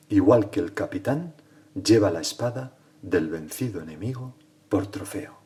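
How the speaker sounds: measured, declaimed verse with swelling line openings; poem recitation. Acted. Priest reading a poem to the ascending Lord, declaimed and solemn. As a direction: recite it solemnly, pausing after each phrase.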